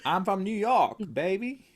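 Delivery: high put-on New York American accent. Shown as a demonstration. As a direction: show off a hammed-up American accent. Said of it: "in an American accent".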